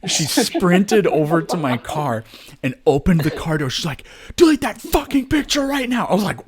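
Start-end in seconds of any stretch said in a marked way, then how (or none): 4.4-6.1 high, loud, barking demand; furious demand. Retold or imitated. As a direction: scream it in someone's face through the car door. Woman demands she delete photo.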